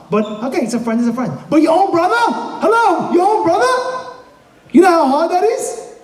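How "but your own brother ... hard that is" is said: high, emphatic disbelief; incredulous outcry. Real, not acted. Preacher stressing betrayal by one's own brother, incredulous outcry. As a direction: exclaim it as if unthinkable.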